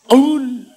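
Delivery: "Qul" loud and high with exaggerated articulation; showily fluent "Qul", punched out loud; over-articulated Arabic. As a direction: overdo the Arabic sounds of "Qul". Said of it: "difasih-fasahin".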